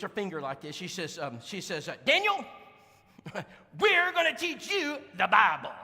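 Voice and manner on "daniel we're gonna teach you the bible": high, loud, commanding wife voice; mimicking the wife. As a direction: wag a finger and lay down the law. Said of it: "I'm gonna mimic this woman".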